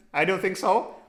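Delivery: normal talking voice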